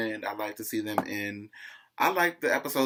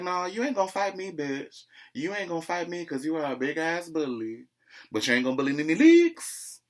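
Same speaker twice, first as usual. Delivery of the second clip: defiant comeback; 6 semitones higher than usual. Retold or imitated. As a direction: act out a sassy refusal to be bullied. Acted quarrel, imitating Nene's comeback.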